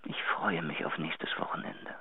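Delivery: whisper. Acted. Intimate message to his patroness.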